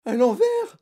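high, loud, panicked question; alarmed disbelief; stammering dismay. A narrator's reading. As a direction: squeak it like a terrified pupil. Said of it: "bégaya Guillaume".

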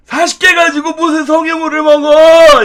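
put-on loud, high scolding old man; grumpy old man. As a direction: scold like a cranky grandpa. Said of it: "그러면은 이제". (호통치는 할아버지)

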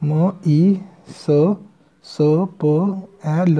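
each letter drawn out, very slow; spelling it out slowly. Shown as a demonstration. Shorthand teacher spelling out an outline for students, very slow. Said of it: "इस तरह से लिखेंगे".

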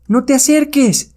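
high, slow cry to keep back; frightened warning. Acted. Witch warns the hypnotist away, frightened. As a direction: fend them off in a high voice.

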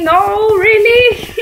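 high, loud, drawn-out wail; dismayed outcry. Real, not acted. Gamer losing the round, dismayed outcry. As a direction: cry out in disbelief, stretching it. Loses the game suddenly.

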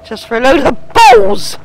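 loud, annoyed blurt; exasperated shout. Real, not acted. Player fed up with a wasted trip, shouts in disgust. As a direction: throw the line out in frustration.

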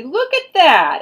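bright, loud excited exclamation. Real, not acted. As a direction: gasp it out with delight. Excited reveal of signed book.

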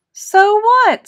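high, loud "So what?"; a boy snapping back at bullies; defiant. Acted. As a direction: throw it back at them, defiant. Frankie defies bullies.

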